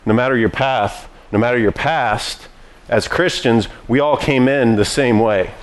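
usual speaking voice

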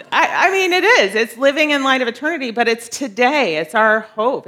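high, loud, laughing recovery; laughing self-correction. Real, not acted. Speaker correcting own slip about the teaching title, laughing loudly.

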